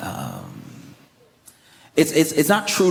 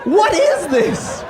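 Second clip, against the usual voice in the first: incredulous rant; 9 semitones higher than usual. Retold or imitated. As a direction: yell like you've never seen anything so crazy. Ranting about weird handshake.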